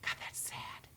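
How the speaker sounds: soft breathy whisper of sorrow; whispered thought. Retold or imitated. Vlogger across the table, whispering sadly. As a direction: breathe it out, saddened. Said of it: "sitting across that table from her thinking".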